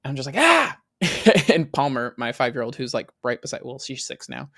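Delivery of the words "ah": short, high, loud yelp of pain; retold scream. Dad retelling a hornet-like sting in the pool, yelped. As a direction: re-enact a sudden sting with a yelp. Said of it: "hear you scream".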